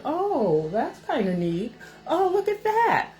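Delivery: high, sing-song admiring voice; mock distracted paramedic. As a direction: imitate someone cooing idly over knick-knacks. Imitates paramedic idly browsing her house.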